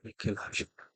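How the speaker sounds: whispered మిక్కిలి ఆశ ఉండాలి, almost breathless; hushed emphasis. Acted. Preacher to congregation, whispering the key line. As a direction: drop to a whisper to drive it home.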